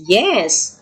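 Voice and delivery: ordinary talk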